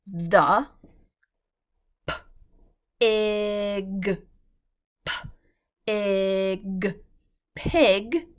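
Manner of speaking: the, then p-ig stretched slowly, blended into pig; sounding out. Shown as a demonstration. Teacher sounding out pig for young readers, slow and loud. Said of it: "teacher sounds out words".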